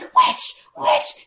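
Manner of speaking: high, sharp mock shriek; high-pitched bickering cry; accusing husband. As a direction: hurl it back in a squawk. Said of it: "he's like".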